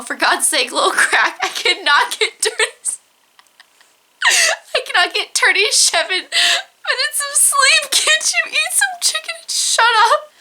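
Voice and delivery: high yell breaking up with laughter; mock yell. [name] yelling at the crying newborn, laughing mock yell. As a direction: yell it squeakily while cracking up. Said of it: "you hear him yell".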